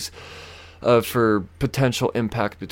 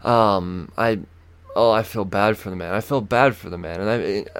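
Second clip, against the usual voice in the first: ordinary talk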